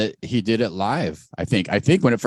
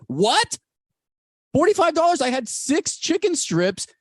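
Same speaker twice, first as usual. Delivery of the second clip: shocked, much higher yelp at the price; outraged disbelief. Retold or imitated. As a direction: react like the bill is a robbery. Outraged at the party bill.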